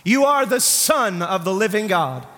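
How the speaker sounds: ordinary talk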